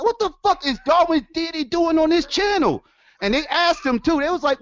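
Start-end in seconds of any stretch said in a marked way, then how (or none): 0.0-2.8 shocked disbelief. Retold or imitated. Retelling his shocked reaction.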